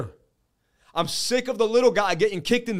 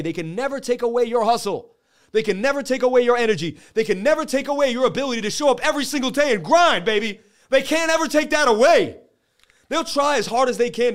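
building rant; 6 semitones higher, 5 dB louder than usual. Real, not acted. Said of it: "I get angry".

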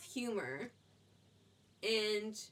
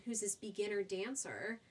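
ordinary talk

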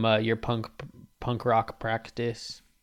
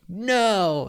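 defiant no; 9 semitones higher, 5 dB louder than usual. Retold or imitated. Teen refuses dad.

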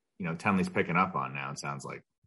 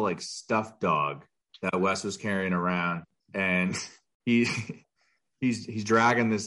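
ordinary talk, nothing marked

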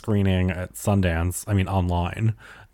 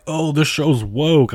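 high, slow mock of critics; pitched-up imitation of people calling it woke; mocking quote; 7 semitones higher, slower than usual. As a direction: sneer it out like a clueless viewer. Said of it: "and they're like".

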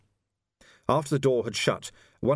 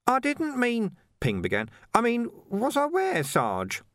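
much higher, hesitant and faltering; faltering Ping. Acted. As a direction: trail off unsure. Ping flustered.

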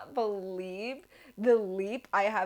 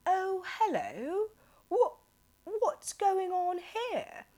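high, slow, very posh British voice; posh British accent; 11 semitones higher, slower than usual. Retold or imitated. Homeowners finding strangers outside, exaggerated posh British accent. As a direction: put on the poshest British accent, pitched up. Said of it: "have the poshest British accent".

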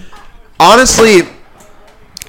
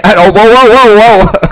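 startled whoa; 8 semitones higher, 5 dB louder than usual. Real, not acted. Reacting to the voice filter.

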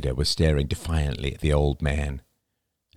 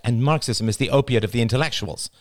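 higher, mildly snappish comeback; snapped retort; 6 semitones higher than usual. A narrator's reading. Diane countering Jada's slogan, snappish retort. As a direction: snap back at her. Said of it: "Diane snapped".

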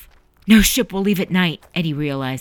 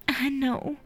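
soft, hushed, high reply; whispered; 9 semitones higher, 7 dB quieter than usual. A narrator's reading. Mother to her departing son, hushed whisper. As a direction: breathe it out gently, barely there. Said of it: "she whispered".

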